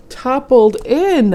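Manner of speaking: storyteller hamming up a tumble; dramatic fall. A narrator's reading. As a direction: let the fall land big and slow.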